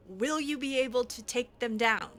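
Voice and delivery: ordinary delivery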